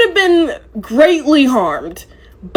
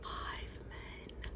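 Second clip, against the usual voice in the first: whisper; 33 dB quieter than usual. Retold or imitated. Stunned recap, whispered repeat.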